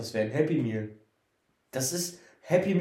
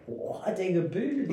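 drawn-out, higher teasing admiration; mock awe; 6 semitones higher, slower than usual. Co-host teases friend's Lexikon plural, mock-impressed. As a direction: slowly marvel at a friend's learning.

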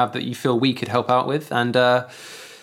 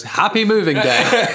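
chirpy, laughing greeting; cheerful villain wishing you well; cheery; 7 semitones higher, 5 dB louder than usual. Retold or imitated. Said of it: "and he goes".

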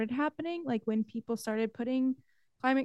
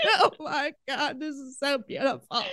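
a pretend sermon line, half sobbing, half laughing; teasing imagined co-preachers, sobbing and laughing; mock crying and laughing; 2 semitones higher than usual. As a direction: play it tearful and giggly at once. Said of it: "crying and laughing".

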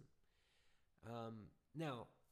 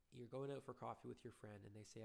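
normal talking voice

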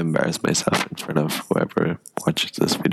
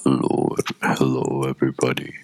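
very low, dark swallowed voice; swallowed dark vowels; 4 semitones lower than usual. Shown as a demonstration. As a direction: sink down low like a subwoofer. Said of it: "I can go like".

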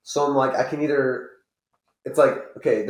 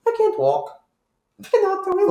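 put-on high, far old lady voice; grandma voice; 3 dB louder than usual. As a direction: wail like a frail old grandma. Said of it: "she has that grandma voice".

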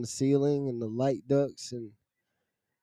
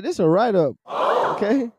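shocked disbelief; 8 semitones higher, 4 dB louder, slower than usual. Retold or imitated. Shocked at being written up.

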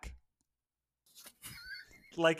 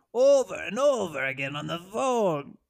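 ordinary talk, nothing marked

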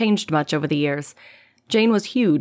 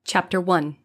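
ordinary delivery

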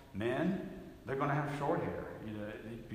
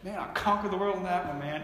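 higher, fast boast; bragging to friends; 6 semitones higher, 4 dB louder, faster than usual. Retold or imitated. Man phoning his buddies to boast about the deal, fast and excited. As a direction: brag to the guys, quick and pumped. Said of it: "you call your guys and you're like".

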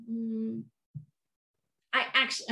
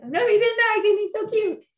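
high, loud gushing squeal over a cute droid; excited squeal; 14 semitones higher, 6 dB louder than usual. Retold or imitated. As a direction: squeal it like a fan melting with delight. Fans gushing over new droid.

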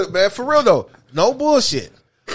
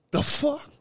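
ordinary talk, nothing marked

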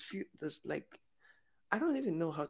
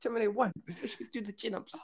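put-on higher, quick amateur New Zealand accent; attempted New Zealand accent; 7 semitones higher, faster than usual. Repeating a favourite film line, rough New Zealand accent. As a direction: mimic the film's New Zealand accent, imperfectly. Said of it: "I don't know how to do the accent".

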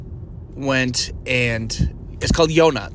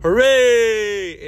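cheer; 9 semitones higher, 4 dB louder than usual. Retold or imitated. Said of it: "teenagers making the donuts go".

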